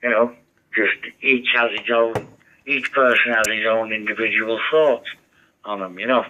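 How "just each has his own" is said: ordinary talk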